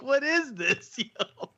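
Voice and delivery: laughing disbelief. Retold or imitated. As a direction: laugh it out in shock. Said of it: "I'm like".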